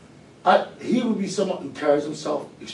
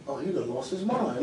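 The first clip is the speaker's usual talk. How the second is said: muttered disbelief; 6 semitones lower, 10 dB quieter than usual. Retold or imitated. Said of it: "Like this".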